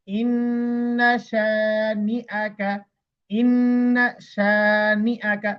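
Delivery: drawn-out chanted recitation; slow Quran recitation. Shown as a demonstration. Teacher recites the verse slowly for students to follow. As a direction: chant each syllable slowly.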